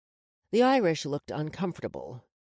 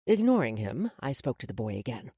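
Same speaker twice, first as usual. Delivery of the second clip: usual speaking voice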